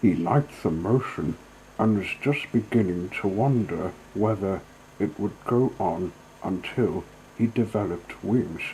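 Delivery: ordinary delivery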